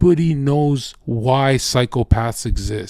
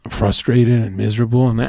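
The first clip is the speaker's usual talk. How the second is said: normal talking voice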